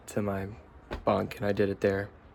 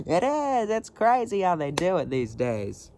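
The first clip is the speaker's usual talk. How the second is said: Linda Belcher impression; 7 semitones higher than usual. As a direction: squeal about the whipped cream like a kid. Linda voice continues.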